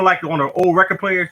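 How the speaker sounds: normal talking voice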